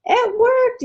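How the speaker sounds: high, loud happy cry; excited yell. Acted. Beanie yelling to Nani on the phone, thrilled. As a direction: shout it with joy. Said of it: "yelled Beanie".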